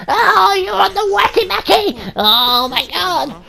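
laughing at the stoned character, yelling; laughing shout. Real, not acted. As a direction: shout at the idiot while cracking up. Yelling at his character smoking.

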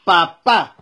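papá with the second á leaned on, loud; stress on second A. Shown as a demonstration. Father showing his son papá, stress on second A. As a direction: say papá firmly, stressing the last á. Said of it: "En la segunda A".

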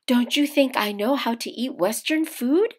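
put-on young Japanese bride, puzzled; mother's voice. A narrator's reading. Mother's voice in story.

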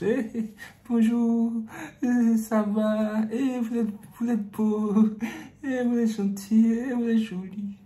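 high, sweet seductive voice; sugary voice. Acted. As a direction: coo sweet flattery slowly. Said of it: "une voix doucereuse".

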